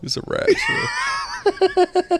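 very high, slow stretched shout; very high, slow mock yell; hammed-up soldier. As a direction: drag it out like a goofy guard. Joking German soldier voice.

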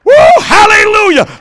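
ordinary delivery

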